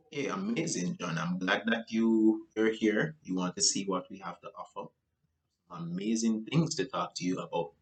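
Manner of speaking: soft, calm friendly reply; matched calm tone. Shown as a demonstration. Salesman matching John's tone, soft and calm. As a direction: keep it down at his level. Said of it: "if you realize with my voice".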